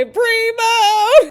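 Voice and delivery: a sudden high, loud shout of the name; shouted cheer. Real, not acted. Fan cheering the Bella Twins' return with a loud shout. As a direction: call it out like a fan in the crowd.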